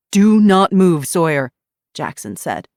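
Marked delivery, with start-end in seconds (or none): 0.1-1.5 loud warning. Acted. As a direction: bark the warning. Officer warning.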